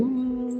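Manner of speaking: very high-pitched thin hum; squeaky little drone. Retold or imitated. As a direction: imitate a tiny voice with a sustained high hum. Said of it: "aquela vozinha bem fininha".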